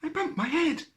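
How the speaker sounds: high, small, grumpy rabbit voice; a sulky little bunny complaining; cross little voice. A narrator's reading. Little rabbit grumbling up from the bottom of the well. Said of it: "A rather cross little voice answered".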